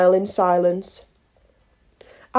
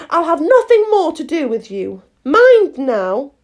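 raised-pitch stern warning; serious Alice; 5 semitones higher, 4 dB louder than usual. A narrator's reading. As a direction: lay down the law as Alice. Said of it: "said Alice seriously".

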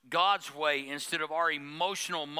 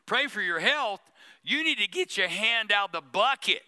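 louder, higher teasing retort through laughter; mock blunt reply; 5 semitones higher, 4 dB louder than usual. Pastor teases a churchgoer holding fried chicken, louder and laughing. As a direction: laugh while giving the tough answer. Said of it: "I'm like".

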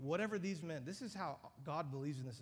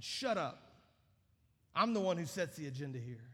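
stern rebuke; 6 dB louder than usual. Acted. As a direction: slam the door on the demon with authority. Jesus rebuking a demon.

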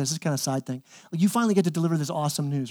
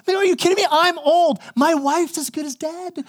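put-on high, incredulous old man complaining; hammed-up disbelief, higher and slowing at the end; mock exasperated Zechariah; 10 semitones higher than usual. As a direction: whine it like a fed-up old priest. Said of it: "He goes".

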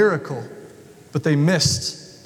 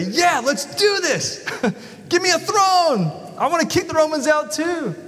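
higher, laughing, hammed-up zeal; mock eager Jesus; 9 semitones higher than usual. Preacher mocks the disciples' wish for Jesus, higher and laughing. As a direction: play it as the throne-hungry messiah they hoped for. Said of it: "Jesus didn't say".

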